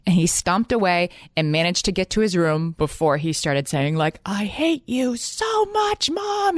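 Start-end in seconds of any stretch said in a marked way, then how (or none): 4.3-6.6 much higher, slow imitating a tween's outburst; mock yell. Son yelling at his mom from his room, imitated. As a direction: drag it out like an upset kid. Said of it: "He was yelling".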